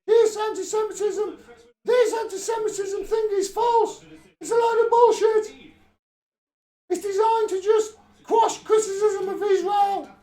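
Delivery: shouting. Pretend. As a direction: shout it out from the edge of the screen. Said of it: "I'm shouting".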